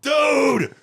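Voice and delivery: loud, high excited yell; excited shout. Real, not acted. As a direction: blurt it out, thrilled. Excited reaction after the impression clip.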